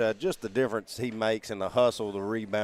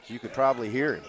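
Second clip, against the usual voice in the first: normal talking voice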